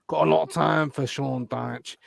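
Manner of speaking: a hint of a Burnley accent, slowed down; lightly voiced Dyche, slow and drawn out; bad Sean Dyche impression. Podcast host doing a terrible Sean Dyche impression. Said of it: "terrible accent, uh, uh, impression".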